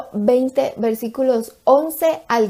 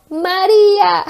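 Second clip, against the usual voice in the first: her name called out high and louder; Jesus calling her name; 8 semitones higher, 4 dB louder, slower than usual. Acted. Jesus revealing himself to weeping Mary, high call.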